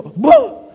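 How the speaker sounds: loud sudden boom, rising high; a big shouted boom as the boat slams down; imitated boom. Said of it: "Ellen would go".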